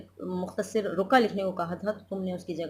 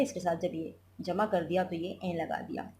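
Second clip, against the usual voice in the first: usual speaking voice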